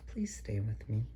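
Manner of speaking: soft whisper; hushed sad plea. Acted. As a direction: ask gently not to be left. Taylor asks quietly.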